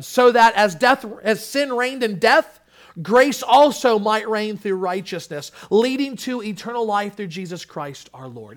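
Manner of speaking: loud, high preached reading of the verse; impassioned scripture reading. Acted. Pastor reading Romans aloud, ringing preached reading. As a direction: declare the verse with fervour. Reads verse twenty-one.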